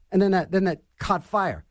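ordinary talk, nothing marked

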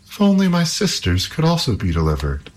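lightly voiced wistful princess. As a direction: sigh it as a hopeful princess. Princess longs for sisters.

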